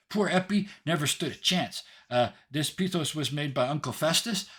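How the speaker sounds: put-on high little-girl explaining voice; tiny know-it-all Hope. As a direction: explain it like the eager pupil given the floor. Hope takes the floor.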